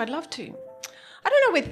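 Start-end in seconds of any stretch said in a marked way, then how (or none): 0.0-0.5 softer, fading. Retold or imitated. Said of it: "and I went".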